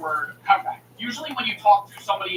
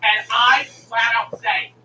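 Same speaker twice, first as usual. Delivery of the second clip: ordinary talk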